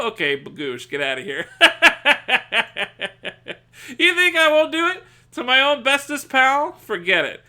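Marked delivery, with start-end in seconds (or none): 4.0-6.7 laughing yell. Real, not acted. Laughing threat to chatter.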